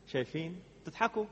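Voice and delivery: ordinary talk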